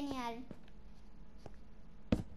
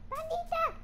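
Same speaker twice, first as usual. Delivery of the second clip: high, sing-song cooing call; calling the panda; 9 semitones higher, 3 dB quieter than usual. Real, not acted. Player coaxing a hiding panda to come out, high cooing call. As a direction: coax the panda out in a high little voice.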